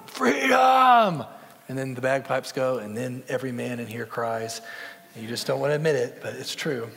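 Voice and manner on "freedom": a soaring high full-throated cry of freedom; screamed freedom. Retold or imitated. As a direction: scream it out like Wallace's last defiant cry. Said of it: "and he screams out".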